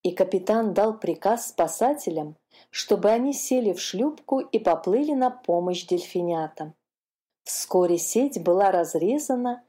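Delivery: ordinary delivery, nothing marked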